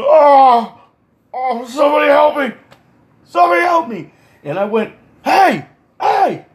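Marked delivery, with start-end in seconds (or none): 0.0-4.1 high, wailing mock plea; imitating a helpless fallen man; cry for help. 5.2-6.5 high, sharp mock shouts; two high-pitched calls; calling out. Teller calls down at fallen man.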